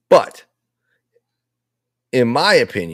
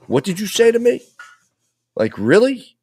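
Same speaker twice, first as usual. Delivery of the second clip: imitating a confrontation; 11 semitones higher than usual. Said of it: "almost to say".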